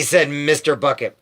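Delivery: ordinary delivery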